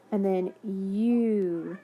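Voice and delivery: slow, drawn-out U; the U stretched slowly; stretched long u. Shown as a demonstration. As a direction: hold the U long like a phonics teacher. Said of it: "that U E".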